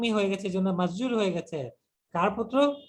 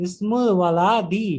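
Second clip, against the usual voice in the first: ইসমুল ওয়ালাদি, slow and louder, every syllable weighted; slow, stressed Arabic; 7 dB louder, much slower than usual. Shown as a demonstration. Teacher drilling the phrase, slow and loud. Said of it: "ওয়ালাদুন হয়নি".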